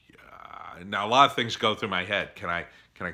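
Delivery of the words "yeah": breathless, voiceless whisper of disbelief; stunned whisper. Retold or imitated. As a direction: barely mouth it, too stunned to speak. Retelling stunned reply to student.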